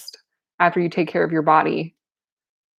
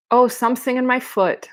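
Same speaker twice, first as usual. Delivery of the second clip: higher baby-talk lisp, mocking; mocking babyish lisp; 6 semitones higher than usual. A narrator's reading. Marianne to injured Carmen, mocking in a lisp. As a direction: mimic a complaint in babyish lisp. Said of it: "mocked in a funny, babyish lisp".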